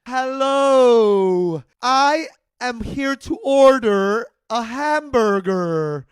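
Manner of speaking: loud, slow, overly formal order; over-enunciate into the speaker box; drive-thru voice. Retold or imitated. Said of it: "Drive-through voice is real".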